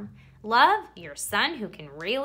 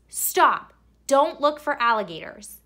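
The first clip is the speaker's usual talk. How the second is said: loud, higher, sharp stop; alarmed command; 4 semitones higher, 7 dB louder than usual. Acted. Worried parents ordering their son to stop, loud. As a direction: shout it like the capital letters. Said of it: "all of these capital letters and put four or five exclamation points".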